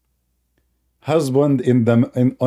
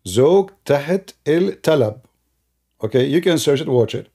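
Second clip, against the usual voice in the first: ordinary talk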